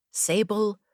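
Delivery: usual speaking voice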